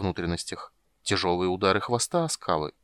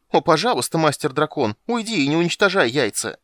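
higher, louder whiny plea; mock pleading; 8 semitones higher, 6 dB louder than usual. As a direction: parody a begging coward. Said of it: "Скажешь".

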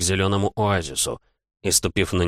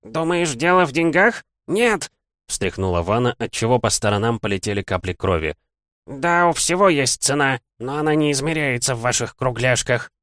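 put-on old hag voice; 6 semitones higher than usual. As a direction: sneer like an ancient hag. Hag lectures the dead man. (голос злобной старухи)

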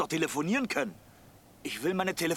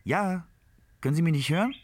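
louder, straining to be heard on the phone; calling into phone; 5 semitones higher, 6 dB louder than usual. Acted. Baumann calling into the phone for Frau Leibniz, loud and straining. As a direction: push your voice down a dead line.

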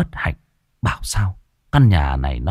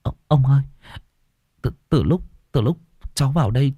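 much higher, fast, stammering and near tears; frightened whimper; 8 semitones higher than usual. A narrator's reading. Tenant begging the old man about the ghost child, terrified and stammering. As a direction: blurt it out terrified, about to cry. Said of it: "Tôi sợ hãi mếu máo".

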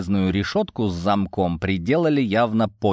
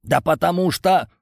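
high, heated burst of irritation; angry hiss; 8 semitones higher than usual. A narrator's reading. Prisoner explains why they attacked him, hissing angrily. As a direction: hiss it like an enraged viper. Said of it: "Йован зашипел, как разозлённая гадюка".

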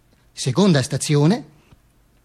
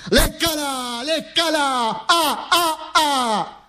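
higher, slow, mocking jeer; jeering crowd; 9 semitones higher, much slower than usual. The mob jeers at the Duce, high and mocking. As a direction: taunt him like a leering mob. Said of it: "la gente mi prende".

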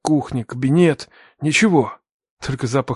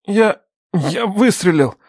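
high, slow, halting confession; struggling for words; 6 semitones higher, slower than usual. A narrator's reading. Son confesses the shooting to father, struggling for words. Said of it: "Слова давались с трудом".

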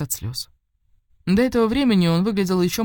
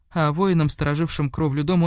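plain reading voice

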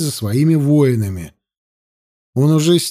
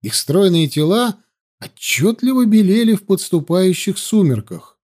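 usual speaking voice

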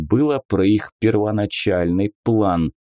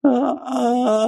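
high wailing creature sob; sobbing wail; 13 semitones higher than usual. A narrator's reading. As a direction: let out a long high wail. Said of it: "зарыдала она".

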